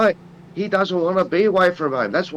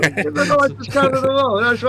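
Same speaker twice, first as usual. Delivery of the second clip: much higher, put-on Aussie bloke voice; mock Aussie fighter; 10 semitones higher than usual. Sending up Aussie fighters to his co-host, in a high mock Aussie voice. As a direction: ham up the friendly Aussie fighter.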